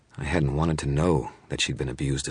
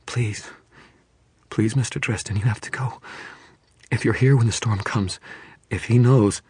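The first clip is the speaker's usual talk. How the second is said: tearful pleading; 8 semitones higher, 4 dB louder than usual. A narrator's reading. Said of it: "broke down into more tears".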